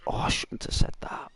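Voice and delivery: hushed, breathy self-doubt; doubting inner voice. Retold or imitated. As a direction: mutter the regret under your breath. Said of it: "when you have doubts".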